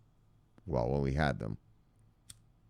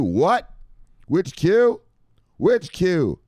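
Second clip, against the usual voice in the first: surprised; 11 semitones higher, 7 dB louder, much slower than usual. Real, not acted. Shocked reaction to headline.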